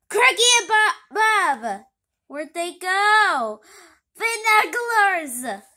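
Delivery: loud, high panicked yells; frantic shouting. Acted. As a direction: shout in alarm at the sky. Villain startled, calling out.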